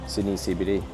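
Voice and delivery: normal talking voice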